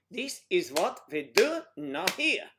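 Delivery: loud, high German-accented command; abrupt mock German. Shown as a demonstration. Demonstrating German to a learner, loud abrupt German accent. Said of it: "It's a little more abrupt".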